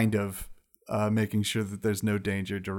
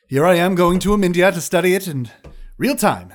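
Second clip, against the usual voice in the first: excited; 7 semitones higher, 9 dB louder than usual. Acted. Excited wizard voice.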